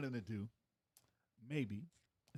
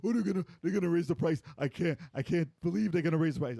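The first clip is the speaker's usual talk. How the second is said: high, loud panicky imitation; mock complaining crowd; 7 semitones higher, 6 dB louder than usual. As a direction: wail like outraged internet commenters. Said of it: "everyone cried about it".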